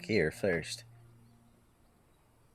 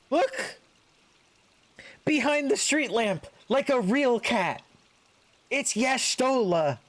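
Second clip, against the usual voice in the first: put-on very high, slow, squeaky child's cry; excited child voice; 4 dB louder, slower than usual. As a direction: point and shout like a thrilled little kid. Excited child spots cat-woman.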